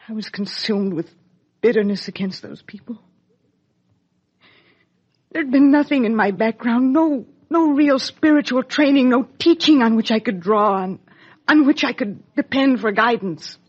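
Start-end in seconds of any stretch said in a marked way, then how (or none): 5.3-13.6 higher and louder, pressing each phrase; impassioned self-defense. Acted. Mother explaining her bitterness to her daughter, impassioned and louder. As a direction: push out the excuses with rising feeling. Mother's anguished confession to child.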